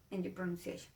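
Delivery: ordinary talk, nothing marked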